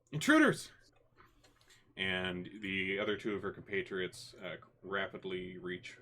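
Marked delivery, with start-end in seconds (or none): 0.1-0.7 high, loud cry of alarm; sudden high-pitched yell raising the alarm; alarmed shout. Acted. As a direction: shout a warning to the guards beside you.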